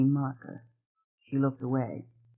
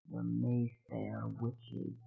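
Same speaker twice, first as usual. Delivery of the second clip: slow, softer, low and harsh; harsh deep whisper; 5 semitones lower, 13 dB quieter, much slower than usual. A narrator's reading. Old woman naming the witches in a harsh whisper. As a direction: breathe it out low and harsh, slowly. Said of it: "she said in a harsh, deep whisper".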